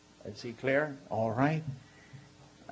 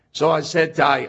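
ordinary talk

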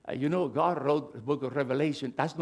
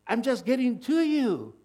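high, loud, eager reassurance; voice of God; 9 semitones higher, 4 dB louder than usual. Acted. God speaking to a lost soul, high and eager. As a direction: reach out eagerly to the listener. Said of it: "He said".

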